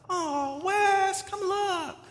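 high soft cooing call; imitating wife. Pastor mimics wife calling him to see baby photo, soft high coo. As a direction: mimic the wife gushing over a baby picture. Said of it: "I get this all the time".

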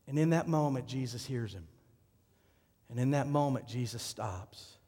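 ordinary delivery

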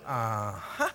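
low, soft knowing uh-huh from a stern God; stern God noting the mistake. Retold or imitated. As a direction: catch them out with a low, smug grunt. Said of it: "he goes".

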